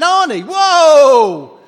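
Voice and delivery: high, drawn-out loud cry of protest; protesting shout. Acted. Cinema-goer calling out to Arnie as credits roll, protesting shout. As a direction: yell it slowly in mock dismay.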